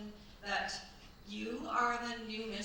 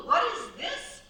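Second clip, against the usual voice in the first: much higher and louder, thrilled; delighted surprise; 14 semitones higher, 8 dB louder than usual. Acted. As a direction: gasp it out, struck by the dress. Discovers the frock in the trunk.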